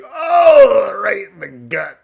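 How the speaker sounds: loud high "oh" falling away; mock pained cry. Acting out a pitchfork in the gut, mock pain. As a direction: cry out as if struck, then sag.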